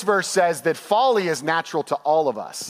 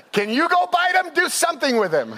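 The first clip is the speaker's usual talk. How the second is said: exasperated plea; 10 semitones higher than usual. Retold or imitated. Nursery worker pleads, retold.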